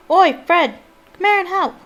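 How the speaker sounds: a cheeky twin yelling for Fred; calling out. A narrator's reading. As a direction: shout for your brother to come help. Twin calling to brother across.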